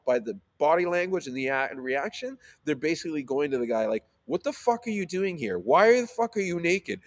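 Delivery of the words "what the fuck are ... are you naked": retold indignant confrontation. Acts out crew confronting naked man.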